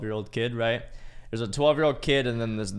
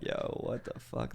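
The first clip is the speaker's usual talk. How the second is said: slow, hushed disbelief; shocked whisper; 8 dB quieter, slower than usual. Retold or imitated. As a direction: whisper it as a stunned aside. Shocked aside after the scolding.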